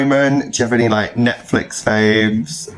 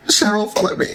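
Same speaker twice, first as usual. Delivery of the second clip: high, sing-song plea to a celebrity; playful call-out; 12 semitones higher than usual. Pretend. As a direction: call up to Cheryl like she might hear. Calling out to Cheryl jokingly.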